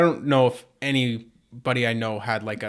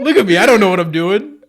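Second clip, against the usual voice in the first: much louder laughing voice; 4 semitones higher, 9 dB louder than usual. Real, not acted. Friend mocking the clumsy worker, loud laughing voice. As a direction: laugh through the joke.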